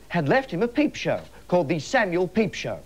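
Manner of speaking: ordinary delivery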